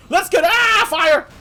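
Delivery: high, loud cry of alarm; startled yell. Acted. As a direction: break off into a frightened shout. Startled by fire.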